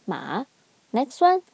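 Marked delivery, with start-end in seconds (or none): 0.1-0.4 "ma" low, dipping down and up; low third tone. Shown as a demonstration. Teacher showing third tone, "ma" low and dipping. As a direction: drop "ma" deep, then let it rise. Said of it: "That's third tone".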